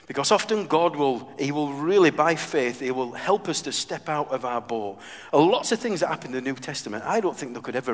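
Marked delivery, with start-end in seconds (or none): none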